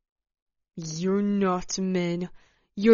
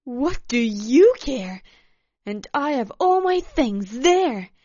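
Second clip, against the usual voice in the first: high, loud, distraught tirade; heated, high-pitched complaint; angry protest; 6 semitones higher, 7 dB louder than usual. A narrator's reading. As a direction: protest loudly, climbing higher in pitch. Acted quarrel, furious woman protesting.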